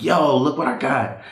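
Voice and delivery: bursting to show off his toy; excited show-off. Retold or imitated. Boy showing his older brother the Lego gun, eager and excited.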